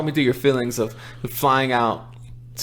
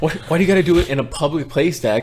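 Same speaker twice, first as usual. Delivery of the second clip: higher, quick mock complaint; mock exasperation; 4 semitones higher, 4 dB louder than usual. Son jokingly scolding his dad for crying in public, mock exasperated. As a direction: plead in playful exasperation.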